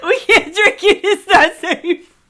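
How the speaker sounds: loud laughing refusal. Real, not acted. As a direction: laugh hard while refusing. Refusing drink, laughing loudly.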